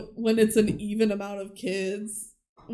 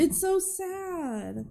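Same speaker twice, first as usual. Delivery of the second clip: much slower and softer, drawing out the sadness; drawn-out sad; 7 semitones higher, 7 dB quieter, much slower than usual. Real, not acted. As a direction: let it sink, stretching "so sad". Repeats it slowly, quieter.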